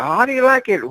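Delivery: mock doll taunt. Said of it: "When he's like".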